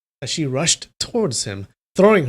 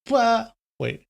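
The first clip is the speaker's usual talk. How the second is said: surprised; 9 semitones higher than usual. Real, not acted. Reacts in surprise.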